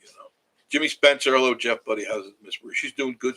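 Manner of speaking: normal talking voice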